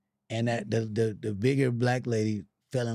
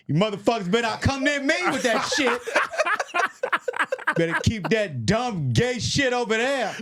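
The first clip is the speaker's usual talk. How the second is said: high, loud mocking yell of a homeless dude; higher mock threat, laughing through it; angry homeless man; 9 semitones higher than usual. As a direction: bark it like an outraged street guy. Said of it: "he was so loud about it".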